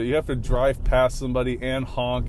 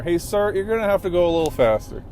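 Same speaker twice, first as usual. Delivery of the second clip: higher, fast sarcastic yell at the driver ahead; 6 semitones higher, 4 dB louder, faster than usual. Real, not acted. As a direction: tell him off from behind the wheel. Yelling at a slow driver.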